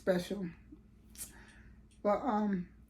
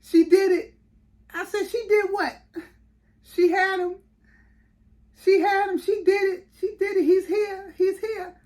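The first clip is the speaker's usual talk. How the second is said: higher, louder, tearful blurted news; imitating crying boyfriend; 10 semitones higher, 5 dB louder than usual. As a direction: retell the boyfriend's sobbing, overwhelmed announcement. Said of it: "he was crying".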